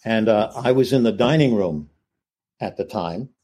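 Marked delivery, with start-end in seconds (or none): none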